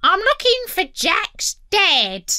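high-pitched parrot squawk; squeaking parrot. A narrator's reading. Parrot asking after Jack's dad, squeaking. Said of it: "and squeaking".

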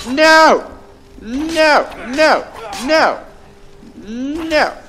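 loud, high cries of no; high-pitched protest, one burst after another; exclaimed no. Real, not acted. Repeated cries at game event.

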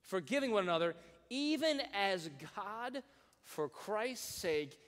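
slow, solemn verse reading; slow scripture reading. Acted. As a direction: let each phrase land slowly.

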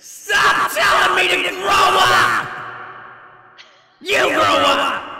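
very high, drawn-out tantrum scream. Pretend. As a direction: scream it at full volume, stretching words. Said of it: "You screamed so loud".

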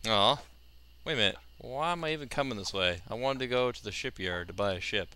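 ordinary delivery

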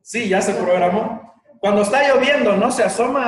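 ordinary talk, nothing marked

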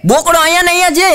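high, fast panicked shout; husband finds the goat still at home and yells in alarm; shocked yell. Retold or imitated. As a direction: blurt it out fast in disbelief. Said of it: "આણે રાડ નાખી".

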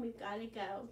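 ordinary delivery, nothing marked

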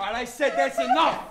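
loud, high call to stop; angry shout. Acted. Intruder silencing a quarrel over problems, angry shout. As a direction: shout down the whole argument.

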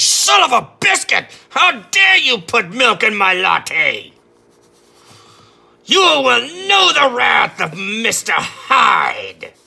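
high, loud, slow hammed-up villain rant; raging Mister Hyde. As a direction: shriek it like a furious monster over a latte. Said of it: "He's like".

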